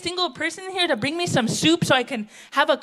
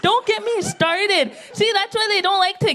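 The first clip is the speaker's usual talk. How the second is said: mock exasperation; 6 semitones higher than usual. Flustered, higher, slower.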